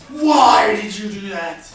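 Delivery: loud, slow accusing why did you do that; demanding why. Shown as a demonstration. Teacher demonstrating an accusing why question to students. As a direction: demand an answer, stretching each word. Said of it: "this is more of a".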